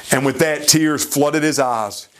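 normal talking voice

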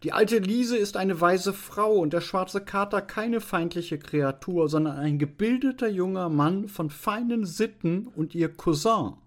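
high-pitched, put-on female figure, incensed; indignant protest. Veronika defending the old woman and tomcat, indignant. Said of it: "rief Veronika mit zornglühenden Augen".

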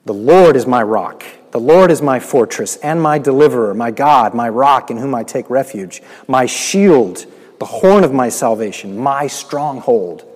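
loud proclaimed psalm; preaching at full force. Acted. Preacher proclaiming the psalm to the congregation, loud. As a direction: proclaim each line loud and strong.

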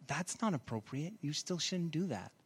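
soft, prim disapproving; prim disapproval. Retold or imitated. Pastor mimicking his own prim objection, softly. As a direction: say it like a prissy rule-keeper. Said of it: "I'm like".

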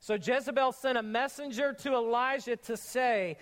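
ordinary delivery

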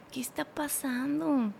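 slow, hushed inner question; retold quiet bewilderment. Girlfriend wonders to herself during his outburst, quietly bewildered. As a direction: voice a stunned thought softly.

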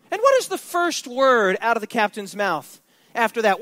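normal talking voice

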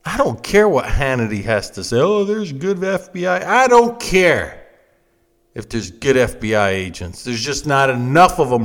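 ordinary talk, nothing marked

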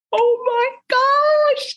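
high, slow squeal of delight; gushing. Acted. Vlogger raving about the Matrix, high drawn-out squeal. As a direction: swoon over the news. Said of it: "gushing again".

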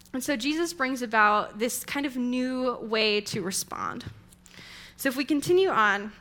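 ordinary talk

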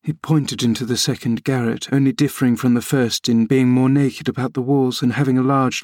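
plain reading voice

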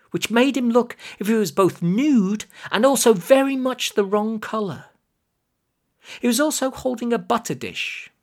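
plain reading voice

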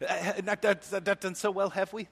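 put-on British accent. Jesus ribbing the empty-netted disciples in a British accent. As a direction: play Jesus as a cheeky Brit. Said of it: "He gets British at this point".

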